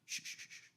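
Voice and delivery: repeated short "shh" to hush someone; hushing someone mid-explanation; imitated shushing. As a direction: cut them off with rapid shushes. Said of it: "he goes".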